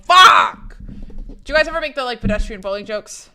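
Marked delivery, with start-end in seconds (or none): 0.0-0.8 sudden loud high curse; startled curse. Retold or imitated. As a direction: blurt it out in shock. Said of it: "but I'm like".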